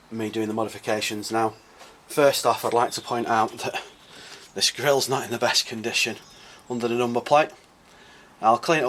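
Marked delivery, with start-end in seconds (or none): none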